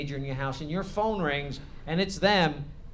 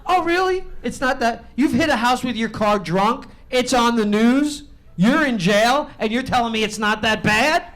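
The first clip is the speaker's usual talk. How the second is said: higher and louder, disbelieving rant; mock furious dad, louder; incredulous yell; 6 semitones higher, 9 dB louder than usual. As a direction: tear into your son in disbelief. Dad yelling at son.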